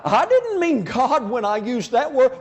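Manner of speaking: hammed-up defensive pleading; mock defensive protest. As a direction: play the objector insisting on innocence. Acted protest in imagined argument.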